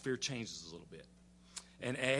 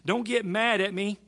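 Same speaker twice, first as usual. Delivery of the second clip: high pleading, growing louder; Abraham pleading; 6 semitones higher, 5 dB louder than usual. Retold or imitated. Abraham fearing he aggravates the Lord, high placating plea. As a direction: plead higher, as the pesky friend. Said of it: "he's gotta be aggravating the Lord".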